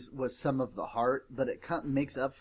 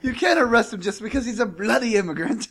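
higher, louder, laughing quote; mock British quote; 8 semitones higher, 9 dB louder than usual. Quoting the movie to his friends, laughing British voice. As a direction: toss it off with a laugh, half British. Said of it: "he'd be like".

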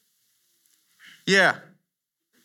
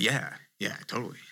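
much softer, lower, deadpan yeah yeah; sarcastic fishermen; 10 semitones lower, 11 dB quieter, faster than usual. Retold or imitated. Tired fishermen grumbling sarcastically at their bad luck. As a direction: mutter it like weary sarcastic fishermen. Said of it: "All the fishermen are like".

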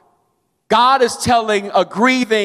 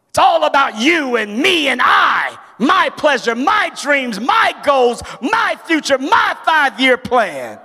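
preaching at full force; 6 semitones higher than usual. Acted. Preacher mocking the self-centred listener's priorities, building full-force preaching. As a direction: build it higher line by line, ringing out.

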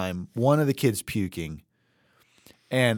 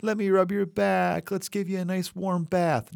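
higher, softer, sugary caretaker voice; doting caring parent; 6 semitones higher, 4 dB quieter than usual. Retold or imitated. Imitating a caring parent soothing a sick kid, higher and softer. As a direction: coo over a sick child like a sweet nurse.

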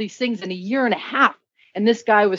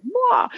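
high, hammed-up crying wail; mock wail. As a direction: imitate a bawling sob, half joking. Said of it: "And I was just like".

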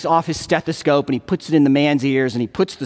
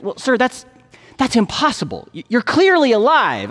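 higher-pitched, halting disbelief, lightly acted; confused doctor; 6 semitones higher than usual. Doctor answers patient who claims he is dead, mildly confused. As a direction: stumble over it like a baffled doctor. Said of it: "looks very confused and goes".

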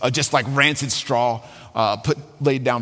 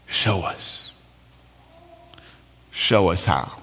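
hushed, breathy murmured prayer; praying whisper; 7 semitones lower, much slower than usual. Retold or imitated. Betsy murmurs a prayer in the flea barracks, hushed. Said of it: "realize that her sister is praying".

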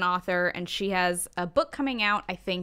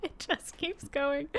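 usual speaking voice

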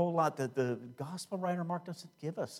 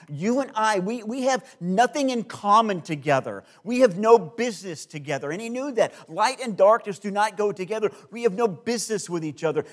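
loud, higher, driving sermon voice; full-force preaching; 7 semitones higher, 10 dB louder than usual. Acted. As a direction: proclaim the battle of light and darkness. Full-force preaching on spiritual battle.

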